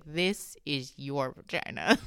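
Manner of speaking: slow, low, word-by-word verdict; deliberate pronouncement. Retold or imitated. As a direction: imitate her spelling it out slowly. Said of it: "she was like".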